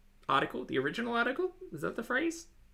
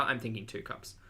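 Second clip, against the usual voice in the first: ordinary delivery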